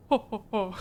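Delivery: hammed-up ho ho laugh; a hint of a scheming lord's laugh; deep mock laugh. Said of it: "when I laugh like that".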